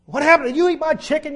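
loud, high accusing yell; hammed-up outburst at the stepfather; blowing up. Preacher acts out yelling at stepfather, mock furious shout. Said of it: "I just kinda blew up on the guy".

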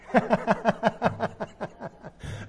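amused chuckle; laugh. Real, not acted. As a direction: laugh at the joke you just quoted. Laughs at his own rhyme.

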